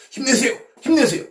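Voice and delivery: loud, high cheer; rally a tired friend; eager encouragement shout. Pretend. Angel cheering Jesus on.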